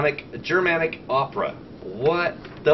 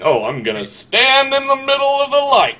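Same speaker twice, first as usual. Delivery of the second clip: higher mocking voice for the hero's plan; 10 semitones higher, 4 dB louder than usual. Reviewer mocking the film hero's plan to co-hosts, higher and mocking. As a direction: ham up the hero's silly plan.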